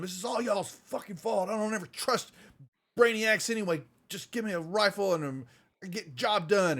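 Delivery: high-pitched mock yell; yelling Reed. Reed yelling at Flynn against the wall, high and angry. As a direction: hurl the blame at him. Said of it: "she's just yelling at him".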